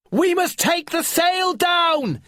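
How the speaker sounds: slow, high, loud yell. Acted. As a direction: yell over the roaring wind. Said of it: "yelled Finn".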